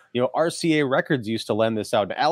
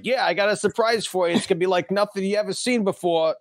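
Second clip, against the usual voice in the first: higher-pitched, hammed-up Australian accent; bad Australian accent; 7 semitones higher than usual. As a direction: imitate a terrible movie Aussie, pitched up. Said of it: "The worst accent ever".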